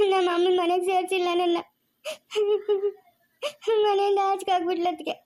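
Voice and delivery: tearful daughter-in-law; crying complaint. Acted. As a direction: sob out the complaint.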